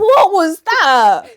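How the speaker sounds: loud, high, drawn-out disbelief; big theatrical outrage at what you heard; incredulous outburst. Real, not acted. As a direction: stretch it out slowly, almost shouting. Repeats it louder, mock outrage.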